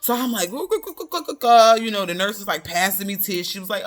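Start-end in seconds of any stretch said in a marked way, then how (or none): 0.5-1.7 higher, louder sobbing gasps; imitating her own sobbing breakdown; mock sobbing. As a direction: blubber out the sobs. Sobbing after the news.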